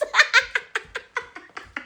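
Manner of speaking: long, high laughing fit; high cackle. Real, not acted. Laughing at the booty shorts picture, high cackle. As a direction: laugh till breathless. Cracks up at own bit.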